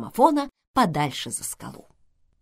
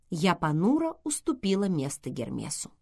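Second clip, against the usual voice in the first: ordinary delivery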